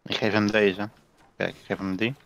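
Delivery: usual speaking voice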